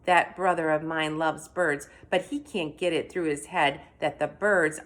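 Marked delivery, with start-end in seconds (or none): none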